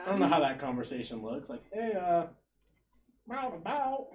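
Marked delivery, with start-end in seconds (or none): 1.7-4.1 higher, falsely casual voice; calling his parents pretending all is fine; sheepish phone voice. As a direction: play it innocent to your parents. Imagined awkward call to parents.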